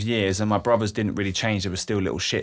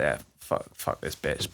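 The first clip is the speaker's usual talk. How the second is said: muttered bitter curse; 7 semitones lower, 7 dB quieter than usual. Retold or imitated. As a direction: lower and softer, half-whispered swearing. Retold bitter inner reaction.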